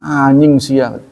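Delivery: "anjing" with NY for J, first vowel drawn out; Bandung pronunciation. Retold or imitated. Teacher imitating Bandung slang, "anjing" pronounced "anying". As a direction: say "anjing sia" as "aanying sia". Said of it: "Kalau di Bandung suka".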